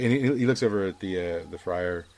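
ordinary delivery

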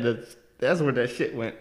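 words squeezed out through laughter; laughing realisation. Retold or imitated. Teller finding the sneeze on own phone, laughing. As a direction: say it while cracking up.

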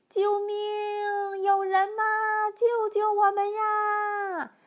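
high, drawn-out terrified wail; hammed-up storybook howls of panic; wailing for help. A narrator's reading. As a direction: cry out desperately, stretching the endings. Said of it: "三个动物嚎啕大哭起来".

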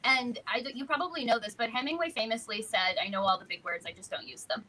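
ordinary talk, nothing marked

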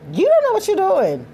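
high, loud, exasperated exclamation; exasperated outburst. Real, not acted. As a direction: throw the line up in disbelief, loud and high. Ranting about angry oral sex.

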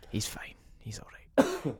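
hushed whisper; breathy whisper. Real, not acted. Friend recovering from choking.

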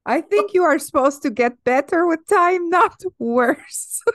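put-on high-pitched comic Russian accent. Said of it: "your alter ego of Russian".

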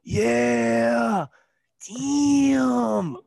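high, loud stretched rapper ad lib. Retold or imitated. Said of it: "made a career off ad libs".

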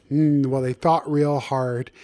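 usual speaking voice

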